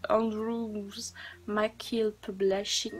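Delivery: slow, careful English accent on "Andrews McKill Publishing". Real, not acted. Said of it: "vive mon accent anglais". (accent anglais lent et appliqué)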